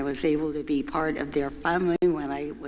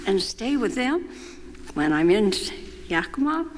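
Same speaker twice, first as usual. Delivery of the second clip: lightly voiced boy, pitched higher and hopeful; a kid asking his mom a favour, a hint of pleading; eager son asking; 6 semitones higher than usual. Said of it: "he was happy to say".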